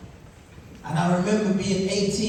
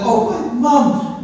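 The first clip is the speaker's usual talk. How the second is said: mock awe; 6 semitones higher, 8 dB louder, much slower than usual. Admiring new trainers excitedly.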